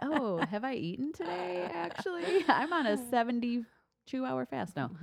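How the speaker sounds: high, surprised self-question; mock surprise. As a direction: act like you just realized. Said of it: "and I'm like".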